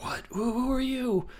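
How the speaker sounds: high, startled mock movie line; startled question. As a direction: play the ex caught off guard, voice jumping up. Ex startled by stranger.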